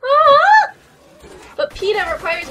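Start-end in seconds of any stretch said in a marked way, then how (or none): 0.0-0.7 loud, high, held "ah"; high shout. Real, not acted. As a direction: cry out on one high note. Cry after the song.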